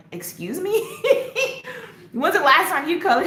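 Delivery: mother's laughing mock offence at her son's tips; mock indignation. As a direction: sass your son back, laughing. Retorting to son Kai's advice.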